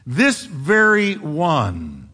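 "This very one" drawn out very slowly; emphatic rendering. Shown as a demonstration. Preacher rendering the emphatic Hebrew word, "This very one" slow and weighty. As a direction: stretch each word of "This very one" with heavy emphasis. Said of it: "is emphatic. It means".

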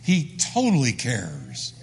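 slow, emphatic stress on "totally". Real, not acted. Preacher assuring the congregation that he cares, slow and emphatic. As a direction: stretch out "totally" with firm conviction, low. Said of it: "I'm gonna tell you emphatically".

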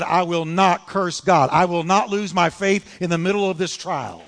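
loud, emphatic refusal; defiant vow. Retold or imitated. Job refusing to lose faith in his trial, defiant. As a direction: declare it forcefully, leaning on NOT. Acts out Job's firm refusal.

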